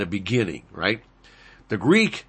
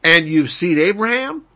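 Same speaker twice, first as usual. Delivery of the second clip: scoffing crowd; 7 semitones higher, 5 dB louder than usual. Acted. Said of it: "they said to him".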